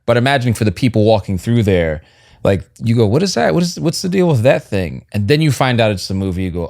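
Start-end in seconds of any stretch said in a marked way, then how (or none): 3.1-5.1 high, curious exclamation; acted puzzlement. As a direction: play a puzzled passer-by, pitched up. Acts out puzzled passer-by.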